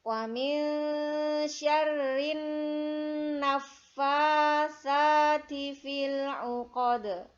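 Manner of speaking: slow, loud melodic chanting; Quran recitation. Shown as a demonstration. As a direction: chant it slowly like murottal.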